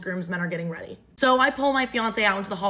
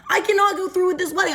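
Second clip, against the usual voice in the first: panicked realization; 9 semitones higher, 4 dB louder, faster than usual. Retold or imitated. Panicked realisation before the wedding.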